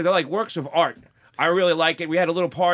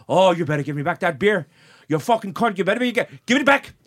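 bad Irish accent; 3 dB louder than usual. Drunk regular wanting his beer back, bad Irish accent. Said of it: "my horrible Irish accent".

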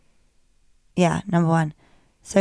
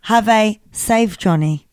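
exaggerated Nigerian accent impression; mock Nigerian accent. Shown as a demonstration. Host demonstrating accent hack, mock Nigerian accent. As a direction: say the farewell in a put-on Nigerian accent. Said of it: "this is the Nigerian".

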